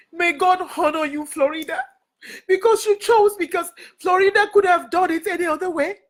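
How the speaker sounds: tearful, high-pitched blessing; real crying. Real, not acted. Speaker blessing Florida for honoring her, crying. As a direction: cry out gratitude through tears. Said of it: "why I'm crying over that banner".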